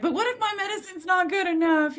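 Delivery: self-mocking high fretful voice; anxious squeak. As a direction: pitch way up like a nervous kid. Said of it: "and then I'm like".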